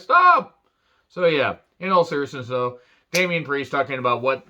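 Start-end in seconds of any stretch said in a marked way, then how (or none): 0.0-0.6 sudden high, loud shout; mock-indignant yelp; mock outburst. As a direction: cut in with an exasperated high yell. Acting out protest at accusations.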